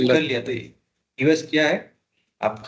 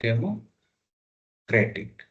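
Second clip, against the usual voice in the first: Democratic stretched out slowly, syllable by syllable; slow repeat; 3 semitones lower, much slower than usual. Shown as a demonstration. Teacher to class, spelling out the word slowly. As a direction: drag out democratic so students catch it. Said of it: "democratic country है".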